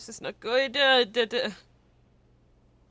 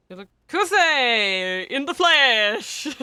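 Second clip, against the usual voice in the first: loud, slow, hammed-up announcement; playful hail; 3 semitones higher, 10 dB louder, much slower than usual. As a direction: trumpet his arrival.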